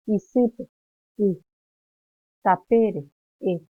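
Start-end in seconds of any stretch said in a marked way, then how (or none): 0.1-0.7 Isýpe with the stress on the ý, said louder and rising; stressed syllable. Shown as a demonstration. Teacher to class, showing the tonic vowel: Isýpe, stress on the ý. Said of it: "la vocal tónica es la A". 2.4-3.1 Tapére said louder with the é stressed; stressed syllable. Shown as a demonstration. Teacher showing the stressed vowel: Tapére, é stressed. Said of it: "la vocal tónica".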